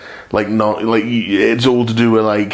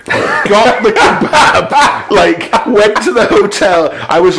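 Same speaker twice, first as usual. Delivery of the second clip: slow, louder, higher through laughter; laughing; 7 semitones higher, 4 dB louder, slower than usual. Real, not acted. Recalling the kebab after the show, laughing loudly. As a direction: laugh the words out. Laughing while telling the story.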